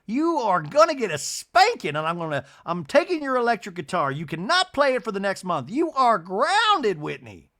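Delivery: high, loud mock mother scolding a child; hammed-up strict mom grounding a naughty boy; imagined stern scolding. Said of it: "I just imagine she was like".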